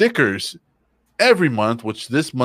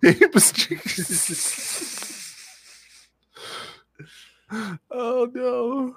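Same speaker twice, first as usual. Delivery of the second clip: mock drawn-out voice; much slower than usual. Mocking the merch item.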